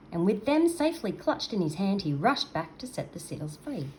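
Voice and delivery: plain reading voice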